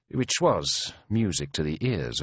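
usual speaking voice

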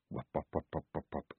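soft low wop wop; whispered helicopter thumping; rotor sound. A narrator's reading. Imitated rotor noise, very soft.

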